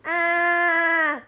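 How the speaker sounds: high loud yelp; loud startled yelp. Real, not acted. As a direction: let out a sudden loud yelp. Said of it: "why would you make that sound".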